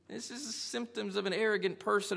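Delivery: ordinary delivery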